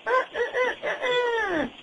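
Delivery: high crowing rooster sound. Shown as a demonstration. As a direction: crow like a cartoon rooster. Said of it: "Does the rooster say".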